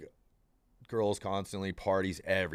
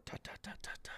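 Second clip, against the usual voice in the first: whispered knocking; 20 dB quieter, much faster than usual. Retold or imitated. Mimicking a knock on door.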